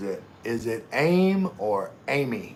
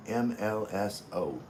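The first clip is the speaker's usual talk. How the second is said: normal talking voice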